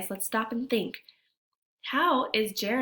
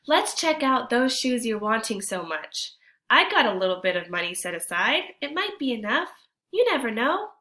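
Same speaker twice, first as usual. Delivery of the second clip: grandma's voice; 4 semitones higher, 6 dB louder than usual. Acted. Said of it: "Grandma says".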